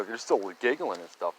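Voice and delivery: ordinary talk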